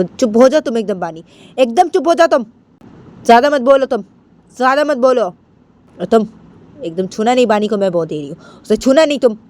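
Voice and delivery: stern grandmother ordering silence, punched words; scolding grandmother. Acted. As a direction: scold the child firmly, pitch lifted from the narration. (डाँटती हुई दादी)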